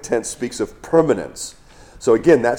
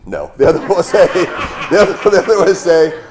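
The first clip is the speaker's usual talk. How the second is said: laughing; 7 semitones higher, 7 dB louder, faster than usual. Real, not acted. Laughs while correcting himself.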